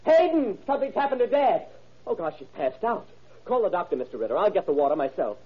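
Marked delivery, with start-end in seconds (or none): 0.0-4.0 high, alarmed call for the butler; frantic calling out. Acted. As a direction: yell for help across the house. Son calls butler, father collapsed.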